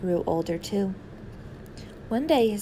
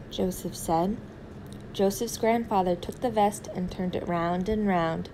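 ordinary delivery, nothing marked

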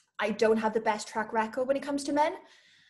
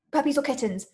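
usual speaking voice

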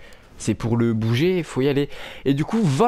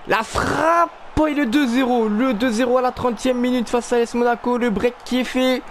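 excited sports commentary; 9 semitones higher than usual. Acted. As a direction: shout the goal like a commentator. Goal in FIFA career commentary.

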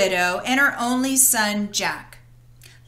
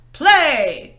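louder curt order; command; 3 semitones higher, 7 dB louder than usual. Acted. Giant orders his enchanted harp to play, commanding. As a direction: bark it at the harp. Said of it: "commanded the giant".

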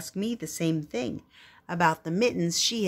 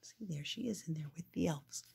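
soft aside; 14 dB quieter than usual. Real, not acted. A parent quietly pointing out the picture. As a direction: point at the picture and speak softly.

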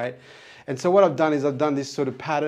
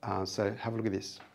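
quiet; 3 semitones lower, 7 dB quieter, faster than usual. Real, not acted. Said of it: "and I'm gonna go quiet".

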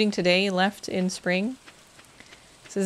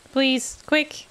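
pleading; 6 semitones higher than usual. Real, not acted. Pleading with timer running.

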